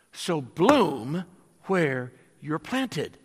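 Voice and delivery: drawn out slowly, each word weighted; slow refrain. Real, not acted. Preacher to congregation, slow weighted refrain.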